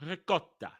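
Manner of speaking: quiet "Ricotta" with a rolled R. Shown as a demonstration. Showing a kid how to say ricotta, R rolled. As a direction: demonstrate "Ricotta", trilling that first R. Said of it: "You gotta roll the R".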